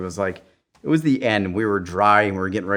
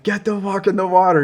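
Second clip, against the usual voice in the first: instructor yelling; 11 semitones higher, 6 dB louder than usual. Retold or imitated. Instructors order them back to sea.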